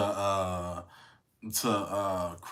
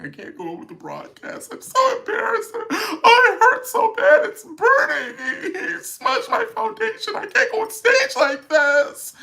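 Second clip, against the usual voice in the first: much higher, louder hammed-up sobbing; mock hysterical crying; 11 dB louder than usual. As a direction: overplay a wailing breakdown, half laughing. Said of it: "I would have been hysterically crying".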